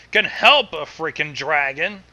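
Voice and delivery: plain reading voice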